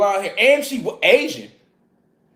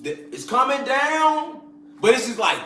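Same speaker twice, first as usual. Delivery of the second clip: usual speaking voice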